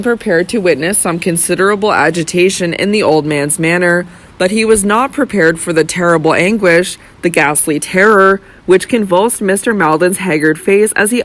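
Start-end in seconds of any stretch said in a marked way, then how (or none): none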